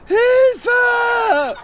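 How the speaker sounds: high, loud cry for help; panicked scream. Acted. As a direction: shriek it out in panic. Schreit um Hilfe. (panischer Hilfeschrei)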